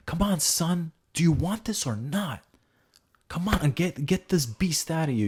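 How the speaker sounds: high, urgent pep talk; motivational dad. Acted. Father challenging his son to compete, motivational urging. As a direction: fire up your son with a rousing push. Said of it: "you gotta be more motivational".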